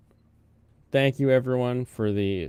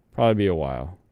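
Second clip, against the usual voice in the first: ordinary talk, nothing marked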